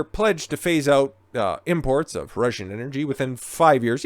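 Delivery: ordinary talk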